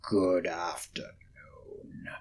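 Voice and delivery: low, slow, hushed whisper; cold dismissal. Acted. Scrooge dismissing his nephew, hushed and cold. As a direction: dismiss him icily.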